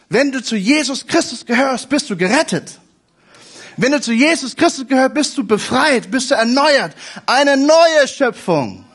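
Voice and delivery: a preacher building from earnest to triumphant; building fervour. Acted. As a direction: rise with conviction and land it big. Preacher rising to climax.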